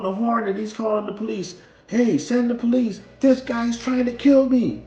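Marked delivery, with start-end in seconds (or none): 1.9-4.8 higher, loud urgent radio call; panicked call. Retold or imitated. Driver on the horn to police, alarmed shout.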